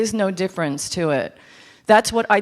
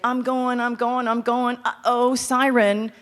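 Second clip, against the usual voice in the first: higher, chugging comic hero; mock journey chant tipping into a comic uh-oh; playful Odysseus; 5 semitones higher than usual. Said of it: "Odysseus is like".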